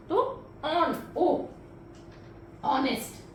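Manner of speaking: "ऑन" drawn out, then "ओ", then "ऑनेस्ट" with silent h; sounding out. Shown as a demonstration. Teacher sounding out honest for students. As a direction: break "ऑनेस्ट" into its opening "ओ" sound. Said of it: "एच की तो sound आ ही नहीं रही है ना".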